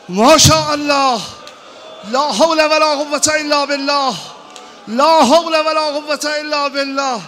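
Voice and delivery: loud, high lament preached at full force; wailing mourning sermon. Acted. Preacher wailing Hajar's grief to the mourners, loud and high. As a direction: cry the story out to the mourners.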